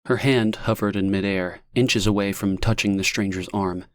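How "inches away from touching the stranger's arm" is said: usual speaking voice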